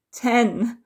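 screamed count. A narrator's reading. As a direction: blurt the count out as the pain peaks. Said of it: "I scream".

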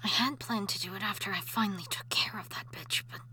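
soft breathy whisper to herself, calming down; hushed whisper. Acted. Ghost wife whispering beside her sleeping husband. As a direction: keep it whispered, don't wake him. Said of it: "I can't get angry".

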